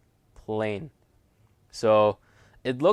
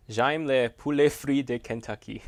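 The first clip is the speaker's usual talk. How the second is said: amateur French with an English-speaker's accent; bad French accent; 3 semitones higher than usual. Shown as a demonstration. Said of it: "say something in my bad accent".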